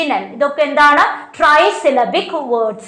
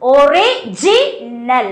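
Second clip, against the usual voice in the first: Original drawn out slowly, with ജി held; syllable by syllable; much slower than usual. Shown as a demonstration. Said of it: "അപ്പൊ stress എവിടെയാ വരുന്നേ".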